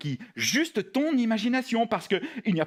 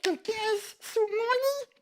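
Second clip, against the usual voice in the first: high, slow scared puppet; frightened puppet; 13 semitones higher, slower than usual. Pretend. Cookie fears someone under the bed, high frightened puppet voice. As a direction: draw it out timidly in a high voice. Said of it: "j'ai la trouille".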